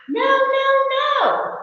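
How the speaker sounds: high, loud, drawn-out moan; storybook moan of dismay; protesting moan. A narrator's reading. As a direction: wail it in protest as Mouse. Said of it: "Mouse moaned".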